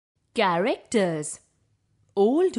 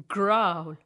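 tiger growl; 3 semitones lower than usual. Acted.

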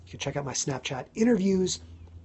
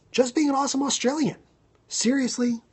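excited thanks; 11 semitones higher, 5 dB louder than usual. Real, not acted. Enthusiastic thanks to Australian viewer.

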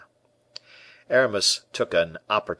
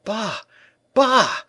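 much higher, louder alarmed bah; shrill panicked outburst, much higher; terrified exclamation; 8 dB louder than usual. A narrator's reading. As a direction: recoil in panic at the request. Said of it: "exclaimed the tailor, terrified".

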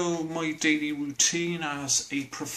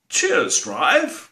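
mock thanks to the bus driver; 5 dB louder than usual. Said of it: "you don't say".

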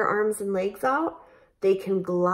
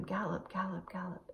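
much softer gallop chant; softly fading; 14 dB quieter, faster than usual. Shown as a demonstration. Teacher chanting the gallop, much softer. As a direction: let the gallops fade away. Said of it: "Gallop, gallop around the room".

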